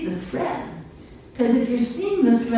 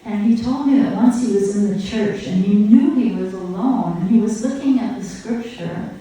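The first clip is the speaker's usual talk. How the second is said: usual speaking voice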